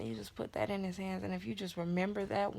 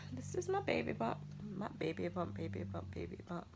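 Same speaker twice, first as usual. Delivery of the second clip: softly whispered, fading to nothing; tender whisper; 5 dB quieter than usual. Real, not acted. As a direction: coo it like a secret. Cooing over her baby bump.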